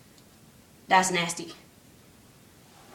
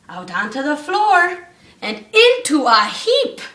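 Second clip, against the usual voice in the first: rhythmic read-aloud storytelling; bouncy storybook reading; story narration; 4 semitones higher, 4 dB louder, slower than usual. A narrator's reading. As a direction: read the rhyme to the class. Reads rhyming Christmas story aloud.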